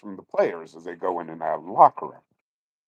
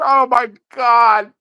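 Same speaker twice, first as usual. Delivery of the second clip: high, slow starstruck gasp of words; a kid overwhelmed meeting a hero; amazed kid; 13 semitones higher, 5 dB louder, slower than usual. Retold or imitated. Imitating the starstruck kid at the fence, high and slow.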